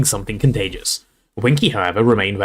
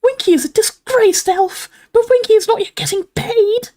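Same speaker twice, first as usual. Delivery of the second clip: furious squeaking elf; 3 dB louder than usual. A narrator's reading. Winky rebuffs Hermione, furious elf squeak. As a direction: squeak it out in offended fury. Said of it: "she squeaked".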